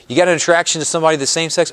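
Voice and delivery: ordinary delivery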